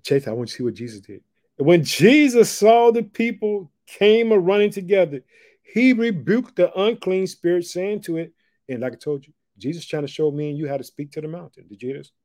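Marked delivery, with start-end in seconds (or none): 1.6-8.3 loud, high preaching burst; full preaching. Acted. Preacher reads to his church, loud and high. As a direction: preach it out to the room.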